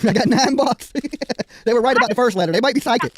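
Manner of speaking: high, loud, laughing through the words; giggling disbelief at the pocket money. Real, not acted. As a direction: crack up while you count the cash. Laughing over counting nine dollars.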